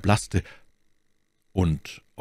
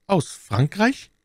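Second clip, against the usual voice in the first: high, slow rising question; astonished question; 12 semitones higher, slower than usual. A narrator's reading. Buckingham hearing of the letter, astonished and slow. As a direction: echo it in drawn-out wonder.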